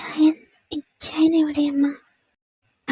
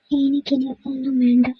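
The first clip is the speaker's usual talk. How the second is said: normal talking voice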